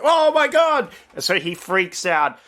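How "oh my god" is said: high loud startled Costello cry; a hammed-up high yelp of fright; mock panicked yelp. Retelling the gambling-room scene, Costello's high shocked yelp. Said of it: "and he's like".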